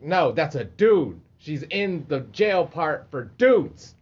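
slow, loud emphasis on each word; mock insistence. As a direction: spell it out to him, drawn out and loud. Retold insistent correction in jail.

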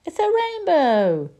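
high loud gleeful cry; Roo's thrilled shout; excited shout. Acted. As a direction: shout it in wonder like a small kid. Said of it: "Roo shouted excitedly".

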